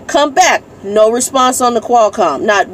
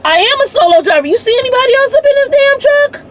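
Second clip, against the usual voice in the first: exasperated; 14 semitones higher than usual. Real, not acted.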